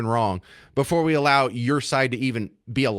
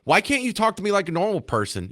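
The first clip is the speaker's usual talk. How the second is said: exasperated complaint; 6 semitones higher than usual. Acted. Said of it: "I feel like they're patronizing".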